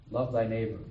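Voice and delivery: ordinary talk, nothing marked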